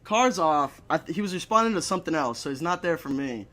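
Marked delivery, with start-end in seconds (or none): none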